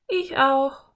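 put-on high-pitched girl character; eager girl. A narrator's reading. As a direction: voice a keen girl, pitched well up. Narrator voices the girl Anne.

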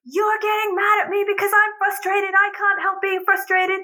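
put-on high-pitched frustrated child's complaint; imitating son yelling. Son protesting to mother about her getting mad, mock yelling. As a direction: act out a worked-up kid protesting. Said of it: "because you are yelling".

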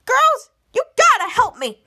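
high, loud panicked plea; mock panic. Riley tied up, begging the girls in high panic. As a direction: cry for help in a cartoonish way. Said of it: "Riley said".

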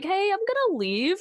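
small, very high voice, rising at the end; lowercase voice. Retold or imitated. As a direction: say it like timid lowercase typing. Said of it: "in my lowercase caps".

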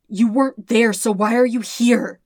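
anguished, accusing cry; distressed outcry. Acted. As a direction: push the question out in rising panic. Zuko confronts Sokka in nightmare.

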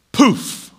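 sharp high magic poof; poof sound effect. Retold or imitated. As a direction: pop it out like a magic trick.

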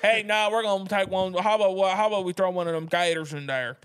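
hammed-up Louisiana Cajun accent, run together; mock Cajun. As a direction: play an eager Cajun cook with a thick accent. Acted Cajun answering the French cooks.

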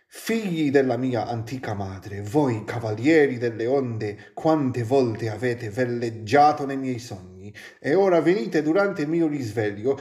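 measured, lyrical reading of Italian prose; Italian poetry recital; poetic reading. Acted. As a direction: read it like a solemn poem. Reads Gibran aloud in Italian.

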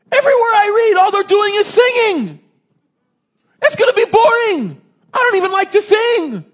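put-on very high, loud kid's wail; squealing mock child tantrum; exasperated young child. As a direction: imitate a small boy protesting at full volume. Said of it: "This is like five-year-old, six-year-old".

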